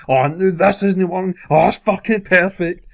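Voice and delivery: high, slow, sarcastic groan; sarcastic exasperation. Retold or imitated. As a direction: drag it out, sarcastically fed up. Sarcastic outburst at small mishap.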